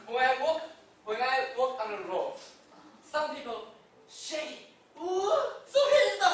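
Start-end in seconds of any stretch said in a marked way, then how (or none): 5.0-6.3 high, loud delighted squeal of admirers; excited squeal. Retold or imitated. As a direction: gush over him like a swooning fan. Passers-by admiring him.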